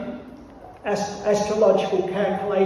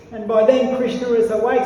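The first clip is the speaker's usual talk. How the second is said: normal talking voice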